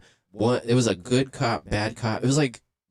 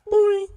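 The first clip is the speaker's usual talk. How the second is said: a high, cartoonish boing; spring-like boing sound effect; mock boing noise; 6 dB louder than usual. Sound effect for hitting the dot.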